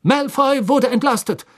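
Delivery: outraged at the accusation; indignant. Acted. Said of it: "sagte Fudge sichtlich entrüstet".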